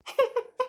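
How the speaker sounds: short chuckling laugh; amused laugh. Acted. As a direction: burst into a brief chuckle. Joking about coming by bus. (ತಮಾಷೆಗೆ ಸಣ್ಣ ನಗು)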